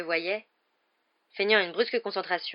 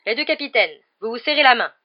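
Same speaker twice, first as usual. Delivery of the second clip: raised, loud official's command; referee's order; 5 semitones higher, 10 dB louder than usual. A narrator's reading. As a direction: call the captains to order. Referee orders the captains before kick-off. (ordre sonore d'arbitre)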